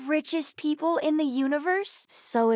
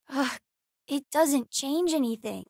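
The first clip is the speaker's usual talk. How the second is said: normal talking voice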